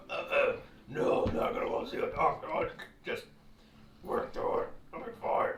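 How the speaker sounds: raspy, breathless croak of a sick man; sick hoarse voice. Retold or imitated. Bronchitis-sick recruit refusing the doctor, hoarse croak. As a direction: rasp it out like your throat is raw. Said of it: "He's trying to talk".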